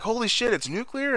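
high-pitched mock disbelief; retold shock. Marty learns the car is nuclear, retold shock. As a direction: question it in startled imitation.

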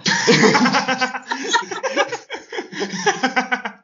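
long hearty burst of laughter. Real, not acted. Laughs at own punchline.

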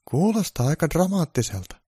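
high elf voice remarking; elf's high, amused comment; curious elf. Acted. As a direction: play a wry little elf. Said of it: "sanoi Johanna tonttu".

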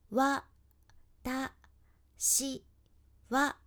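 「わ、た、し、は」を一音ずつ区切って; syllable by syllable. Shown as a demonstration. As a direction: space each sound apart like beads. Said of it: "一つ一つ黒い丸". (一音ずつ区切って発音)

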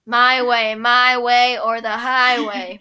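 lightly sassy, loud; a hint of sassy teen; sassy teenage girl. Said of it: "sassy teenage girl".

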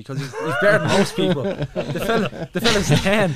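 laughing. Real, not acted. Laughing at own silly logic.

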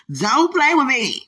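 loud, high, drawn-out playful threat; mock warning. Warning sweetheart while joking, mock jealous.

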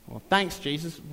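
ordinary delivery

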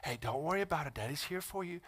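soft, hushed comfort to a little son; gently calm a crying child; tender daddy reassuring his hurt boy, softly; soothing a crying child. Retold or imitated. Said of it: "I'm like".

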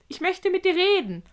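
high, piping creature voice; squeaking spider. A narrator's reading. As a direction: pipe it up like a tiny spider. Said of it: "piepste sie mit einer metallischen Stimme".

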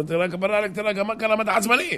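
high-pitched mock-concerned buddy; questioning friend. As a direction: voice a pal asking what's wrong, up high. Friend questions the agitated husband. (חבר שואל בקול גבוה)

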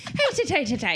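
high mock-Irish nonsense syllables. Friend teasing her about sounding Irish, mock Irish. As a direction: tease with a sing-song Irish imitation.

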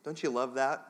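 ordinary talk

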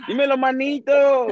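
high, drawn-out playful call; hammed-up slang greeting. Newcomer greeting a buddy in picked-up slang, hammed-up call. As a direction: call out to a buddy in mock local style.

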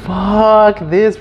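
retold exasperated outburst; alarmed. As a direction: burst out as if needing to get away. Acts alarm at being followed.

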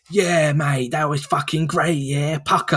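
hammed-up imitation of a bad English accent; Oscar Isaac. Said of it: "where he talks like".

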